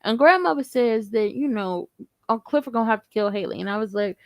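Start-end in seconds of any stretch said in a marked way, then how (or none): none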